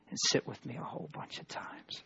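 half-voiced joking aside; whispered aside. Real, not acted. Aside after marriage rule.

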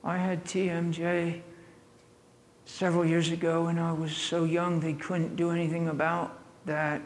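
lightly voiced girl, quiet and soft. Girl explaining her jaw to preacher, softly. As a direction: tell it gently as the hurt girl. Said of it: "She says".

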